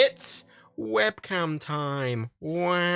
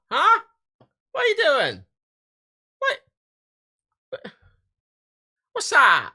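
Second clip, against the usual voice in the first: much higher, bewildered questions; baffled; 11 semitones higher, 4 dB louder, much slower than usual. Real, not acted. Vlogger staring at his mirrored webcam, bewildered. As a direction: react to something baffling.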